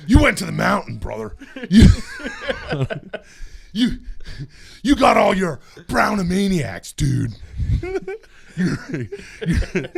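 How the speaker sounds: lightly voiced wrestler promo, brother; a hint of a hyped wrestling promo; hyped Hulk Hogan. Shown as a demonstration. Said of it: "Just whoop".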